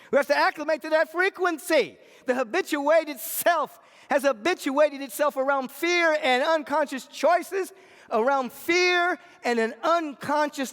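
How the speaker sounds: high, loud, driving sermon; full-force preaching. Real, not acted. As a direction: drive the point home to the congregation.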